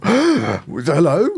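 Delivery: high, rising shocked hello; startled hello. Retold or imitated. Speaker re-enacts meeting a man who walked through the wall, startled. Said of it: "it was actually shocking".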